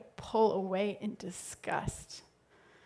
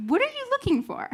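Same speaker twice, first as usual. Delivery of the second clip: much higher, louder friend's voice; friend's incredulous question; 5 dB louder, much faster than usual. Retold or imitated. Friend asked to find her a date, high loud questioning.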